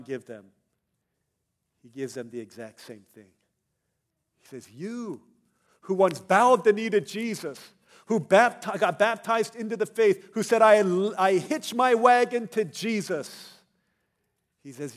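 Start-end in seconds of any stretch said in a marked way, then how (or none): 4.7-13.6 very loud, high pulpit cry; full-force preaching. Acted. John challenging baptized believers living in compromise, thundering preaching. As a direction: call them out with shouted conviction.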